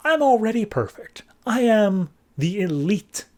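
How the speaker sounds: high, slow, haughty boast; snobby rich alien bragging; arrogant elite. Acted. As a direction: look down your nose at him.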